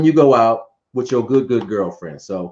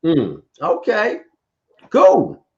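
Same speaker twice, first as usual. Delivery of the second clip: slow, lightly voiced smooth Denzel drawl; a hint of Denzel, stretched out slow; unhurried mock Denzel cool; Denzel impression; 5 semitones lower, much slower than usual. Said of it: "hit you with the Denzel".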